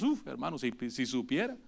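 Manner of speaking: hushed aside. Real, not acted. Pastor confesses bad thoughts.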